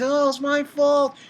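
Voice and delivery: high-pitched imitation of a tearful apology; mock crying. Reviewer imitating Asuna crying to Kirito, high mock crying. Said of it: "And she's crying".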